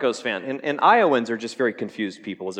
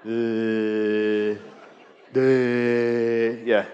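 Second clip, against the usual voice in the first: mock Chiefs fan; 3 dB louder, much slower than usual. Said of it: "kinda like".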